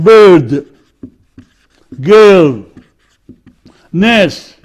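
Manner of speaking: put-on "bird", "girl", "nurse" with a long er vowel; long central vowel. Shown as a demonstration. Teacher giving examples, long central vowel in "bird", "girl", "nurse". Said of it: "What about this one?".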